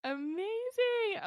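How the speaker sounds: host's beaming sign-off cheer; drawn-out amazing. Real, not acted. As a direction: stretch it with delight. Host reacts to guest plug.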